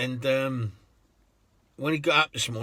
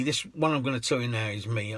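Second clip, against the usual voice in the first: usual speaking voice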